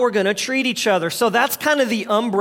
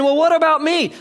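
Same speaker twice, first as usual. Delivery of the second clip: higher, louder mock whine of self-pity; selfish whine; 7 semitones higher, 5 dB louder than usual. Pastor mocking a selfish reply with a mock whine. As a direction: whine it like a hurt child.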